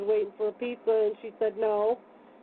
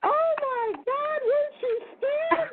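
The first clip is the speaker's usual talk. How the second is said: a much higher, slow, crying mock wail; imitating frightened cashier; slower than usual. Cashier asking the teller if she was scared, high mock wail. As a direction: squeal it out like the shaken cashier. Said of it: "She said".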